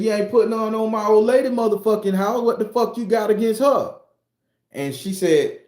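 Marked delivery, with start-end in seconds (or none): 0.0-3.9 high, raised, accusing voice; mock angry yell. Grandson confronting the girl over his grandma's house, high angry yell.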